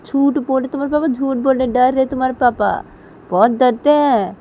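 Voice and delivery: mock him loudly for being scared; loud taunting of a cowardly husband. Siya mocks scared husband loudly.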